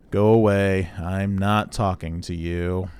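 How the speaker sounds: slow, monotone, dismissive robot; Marvin the paranoid android. Acted. Droid refuses to talk to player, slow monotone. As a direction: drone it out to send someone away. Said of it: "this is very clearly Marvin the paranoid android".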